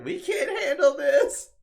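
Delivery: high, slow, comic whine through laughter; laughing Kate Sith impression. Kate Sith panicking against Bahamut Sin, laughing and high. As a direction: squeal it out, cracking up. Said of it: "Kate Sith's all like".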